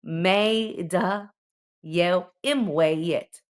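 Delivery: careful teacher demo of Maltese words; example words. Shown as a demonstration. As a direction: spell out "imwejjed" very slowly. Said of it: "per esempio".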